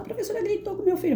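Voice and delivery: rapid, high-pitched mock parent complaint; imitated aggrieved mum, high and hurried; complaining mother. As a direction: mimic a mother rushing to accuse. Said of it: "quando vinha uma mãe e falava".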